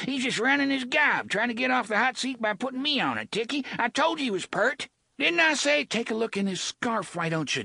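high, fast whiny thug voice; high whiny rogue. A narrator's reading. Gasher's high voiced accusation.

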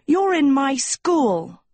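high, slow, indignant insistence; acted possessive outrage; fuming. A narrator's reading. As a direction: stretch it out in a high-pitched huff. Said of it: "Sophie fumed".

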